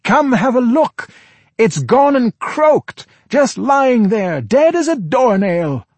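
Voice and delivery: high, slow, bellowed calls; loud shout. Acted. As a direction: shout it into the dark room. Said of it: "in a loud voice shouted".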